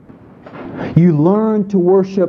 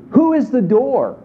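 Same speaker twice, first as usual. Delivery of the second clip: usual speaking voice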